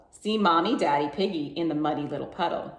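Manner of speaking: ordinary delivery, nothing marked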